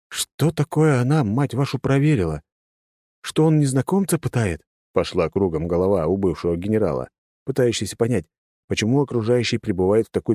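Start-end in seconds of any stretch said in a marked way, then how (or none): 0.1-4.6 exasperated outburst. A narrator's reading.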